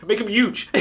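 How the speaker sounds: big enthusiastic cheer; excited push. Real, not acted. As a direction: punch it up with a grin.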